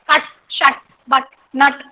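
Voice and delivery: कट, शट, बट, नट with a very short uh; shortest vowel. Shown as a demonstration. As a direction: cut the अ short in each word. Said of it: "This is the shortest अ sound". (कट, शट, बट, नट में सबसे छोटा अ)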